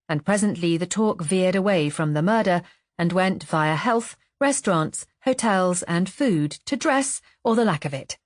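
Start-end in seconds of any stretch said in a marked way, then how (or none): none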